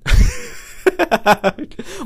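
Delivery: loud, high burst of laughter. Real, not acted. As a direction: crack up at your own joke. Laughs at own mock apology.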